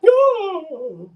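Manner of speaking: high, loud, long "No"; mock wail. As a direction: wail the "No" in pretend protest. Playful protest at the tease.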